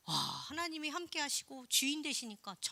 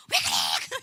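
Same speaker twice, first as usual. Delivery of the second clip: low, gruff mock retort at mom; mock irritated snap; 10 dB louder, slower than usual. Daughter snapping at her mother, mock annoyed. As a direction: bark it at your mother.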